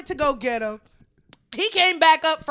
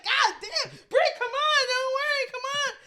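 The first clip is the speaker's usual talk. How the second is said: high strained pleading, a touch of crying; begging a crying friend to come along; imitated pleading; 10 semitones higher than usual. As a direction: coax her, voice pitched up.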